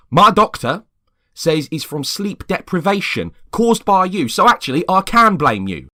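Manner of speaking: loud, high accusing retort; angry retort. Acted. James loudly blaming Peter for his sleepless nights. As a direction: throw the blame back hard.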